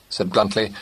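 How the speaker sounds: ordinary delivery, nothing marked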